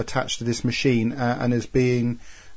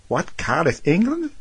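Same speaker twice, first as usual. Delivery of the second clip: rising into a high, baffled "England?"; puzzled American bloke; 5 semitones higher than usual. Retold or imitated. Prank-call victim asks the teen caller in disbelief, baffled rise. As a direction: play the confused stranger, pitch jumping up at the end. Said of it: "the bloke at the other end goes".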